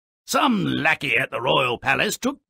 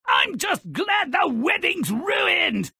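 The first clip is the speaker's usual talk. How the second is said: gloating. Real, not acted. Gloating over ruined wedding.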